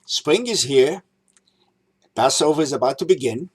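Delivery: ordinary talk, nothing marked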